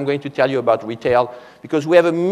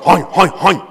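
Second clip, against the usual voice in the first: mock German grunts, a bit louder; German soldiers' speech; 3 semitones higher, 4 dB louder than usual. Speaker mocks how the German soldiers talked, German accent grunts. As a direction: imitate German soldiers going hein, hein. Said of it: "the Germans speak like".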